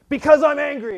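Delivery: sudden high, loud mock-angry cry; abrupt angry outburst. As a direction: burst out in hammed-up divine anger. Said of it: "super herky-jerky like that".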